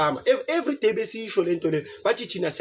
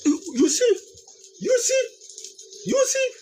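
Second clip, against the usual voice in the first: rising squeaky you see, much higher; teasing you see; slower than usual. Acted. Teasing the listeners with a squeaky high question.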